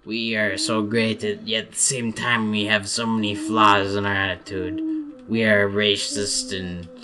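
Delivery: slow, hammed-up French accent; mock French accent. French people praise and damn themselves, slow mock French accent. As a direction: drag it out in a self-important French accent. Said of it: "here's some more French people".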